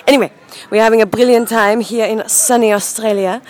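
usual speaking voice

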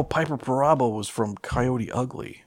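usual speaking voice

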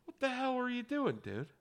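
high, thin mock dad voice. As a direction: squeak it like a baffled father. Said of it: "the dad's just like".

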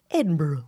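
soft careful "Edinburgh" like a tutorial; pronunciation video voice. Retold or imitated. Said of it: "how to pronounce, I go".

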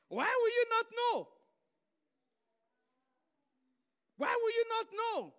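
high, loud mock disbelief; high incredulous repeat. Shown as a demonstration. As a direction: throw the question up high in disbelief. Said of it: "I say".

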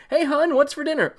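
high, breezy husband home from work; chipper husband. Retold or imitated. Voicing husband coming home.

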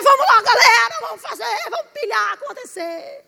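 loud, high hype call; mocking an energetic wife rallying everyone; hyped cheer. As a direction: pump up the crowd. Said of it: "A sua esposa é".

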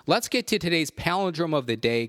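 high, loud announcer call of the segment. Acted. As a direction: announce it like a radio host. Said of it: "segment announcement".

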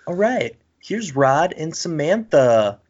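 TV host voice. Retold or imitated. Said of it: "they'll be like".

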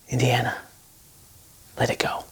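soft, low whisper of a plea. Retold or imitated. Father whispering to his son to let go, hushed. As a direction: breathe it gently to your son.

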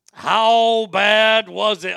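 very loud, drawn-out straight man; Ed McMahon. Shown as a demonstration. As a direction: bellow it slowly as a sidekick's set-up. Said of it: "straight man for Johnny Carson".

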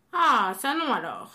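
loud, indignant refusal; outraged oak. A narrator's reading. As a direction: bark a flat no, offended. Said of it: "répondit-il d'un air outré".

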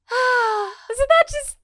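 very high, slow, loud rhetorical question; dramatic exclamation. Acted. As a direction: ask it like it's a cosmic revelation.

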